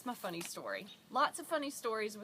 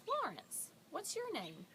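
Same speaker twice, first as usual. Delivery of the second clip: high-pitched imitation of a lady talking to a child; lifted voice for a small listener; patient woman; 9 semitones higher than usual. Woman repeating her name to a three-year-old, high voice.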